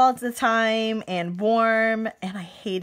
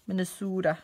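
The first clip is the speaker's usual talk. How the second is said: put-on Minnesota as Minne-SOO-da, in a Minnesotan accent; quick imitation of a Minnesota accent; 3 semitones lower, 5 dB quieter than usual. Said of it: "They would say Minnesota".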